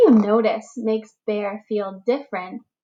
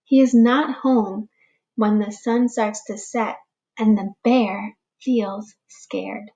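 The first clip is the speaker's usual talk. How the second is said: put-on slow, expressive read-aloud for young children; storybook reading; slower than usual. A narrator's reading. Teacher reading a picture book to the class, gentle storytelling. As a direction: read it like story time for little ones.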